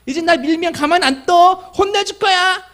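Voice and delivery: put-on high, loud little girl's threat; five-year-old daughter warning the older girls; child scolding. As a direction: squawk it out like a small angry child. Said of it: "소리를 꽥꽥 지르더라고요".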